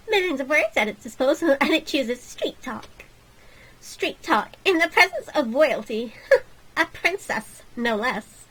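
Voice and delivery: lightly voiced snooty royal; haughty princess. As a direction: look down your nose, prim.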